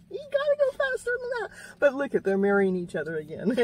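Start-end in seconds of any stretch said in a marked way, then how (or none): 0.0-1.5 laughing. Real, not acted. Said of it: "he's gotta go faster".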